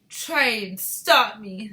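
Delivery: loud, slow defiant retort. A narrator's reading. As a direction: dare them, drawing the words out. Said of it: "said Missus Teavee".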